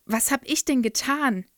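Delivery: put-on genuinely confused child; girl asks what she did wrong; bewildered protest. A narrator's reading.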